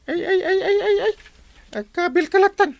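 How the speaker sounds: high wailing lament. Acted. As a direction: wail in dismay. Captain laments what Kwarog did.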